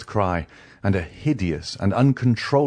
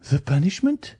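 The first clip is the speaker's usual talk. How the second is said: high-pitched, alarmed question from a frightened wife; startled, high feminine character voice; alarmed exclamation; 12 semitones higher, 3 dB louder than usual. Acted. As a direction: exclaim it in dismay, high and rising. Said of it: "exclaimed Madame de Villefort".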